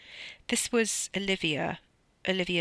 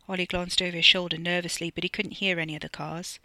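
plain reading voice

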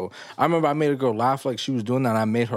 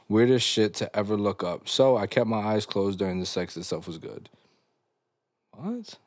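usual speaking voice

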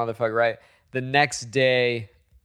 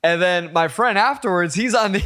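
normal talking voice

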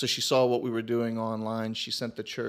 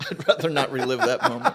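raised, louder voice through real laughter; laughing reluctance; 4 semitones higher, 8 dB louder than usual. Real, not acted. Pastor teased about his own embarrassing story, laughing loudly. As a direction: laugh it off, pitched up.

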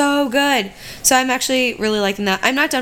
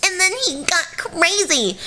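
higher, airy mock fangirl swooning; mock the starry-eyed true crime fan; gushing fan; 6 semitones higher than usual. Mocking true crime fans who gush, in a higher swooning voice. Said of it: "they're gonna be like".

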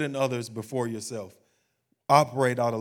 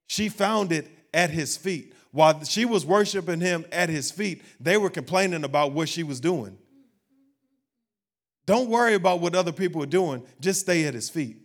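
raised, impassioned pulpit voice; fervent preaching; 4 semitones higher, 6 dB louder than usual. Real, not acted. Preacher urging people to stay at his feet, fervent. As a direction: preach it higher, with rising force.

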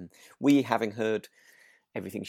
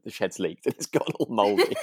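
laughing; 9 semitones higher, 4 dB louder than usual. Real, not acted. Mouldy kit in leaky shed.

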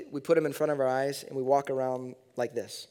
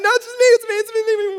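much higher and louder, hurried it's me; frantic, calming a terrified wife; panicked reassurance; 11 dB louder, faster than usual. Retold or imitated. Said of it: "and I'm like".

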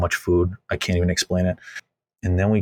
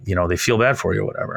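normal talking voice

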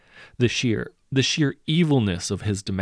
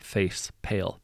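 ordinary delivery

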